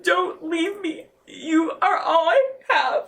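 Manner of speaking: slow, pleading mother with a half laugh; retold pleading. Mother begging her son not to leave, slow plea. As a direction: beg him slowly not to go.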